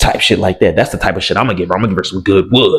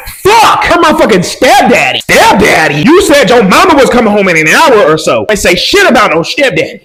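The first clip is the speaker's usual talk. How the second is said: panicked shout; 13 semitones higher, 7 dB louder than usual. Retold or imitated. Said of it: "So I'm like".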